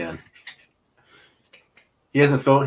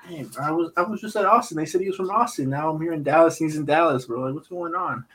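high, incredulous retelling, half joking; baffled disbelief; 7 semitones higher than usual. Retold or imitated. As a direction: voice pitched way up in mock bafflement. Retelling his own baffled reaction.

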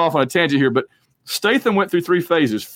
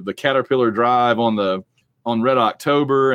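ordinary talk, nothing marked

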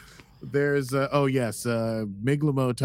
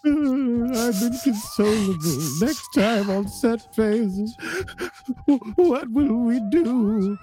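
high, loud, slow wail; mock dramatic lament; 12 semitones higher, 5 dB louder, slower than usual. Host hams up a mournful outro announcement. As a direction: wail it like an overwrought soap character.

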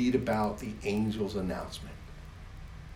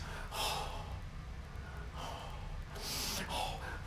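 long joyful gasp; 11 dB quieter than usual. Acted. God gasping.

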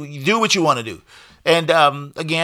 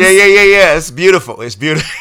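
laughing; 5 dB louder than usual. Real, not acted. Joy at a sound drop.

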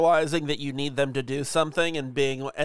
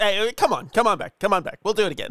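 mock boss beckoning; 6 semitones higher, 4 dB louder than usual. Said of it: "He's like".

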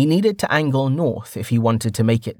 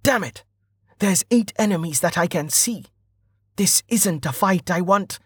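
higher-pitched, worried thinking voice; inner thought; 7 semitones higher than usual. A narrator's reading. Rider counting enemies to himself, higher inner voice.

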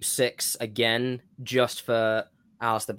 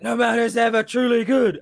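dramatic villain line; 10 semitones higher, 6 dB louder than usual. Retold or imitated. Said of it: "And it's like".

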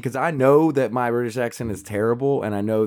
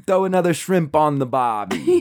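higher, slow, broad Aussie; mock Australian accent; 4 semitones higher than usual. Joking about devolving into Australian, slow exaggerated Australian accent. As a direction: draw it out like a cartoon Australian. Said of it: "That's Australian".

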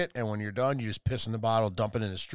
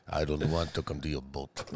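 put-on very low, softer dragon with a Russian accent; mock Russian accent; 12 semitones lower, 8 dB quieter than usual. Dragon refusing the emperor, mock Russian accent. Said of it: "I think the dragon was Russian".

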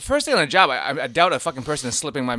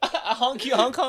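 incredulous; 11 semitones higher, slower than usual. Pretend. Repeating the nickname incredulously.

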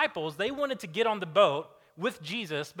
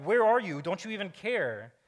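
a raised, higher cry of complaint; desperate plea; 4 semitones higher, 5 dB louder than usual. Retold or imitated. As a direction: call out accusingly. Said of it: "They're like".

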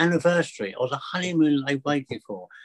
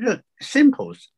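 simples; 11 semitones higher, 5 dB louder, slower than usual. Retold or imitated. Cheerful reassurance to nervous flyer.

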